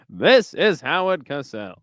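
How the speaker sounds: swooping sportscaster sign-off; Howard Cosell. Acted. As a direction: announce it in halting commentator cadence. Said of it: "Fucking Howard Cosell".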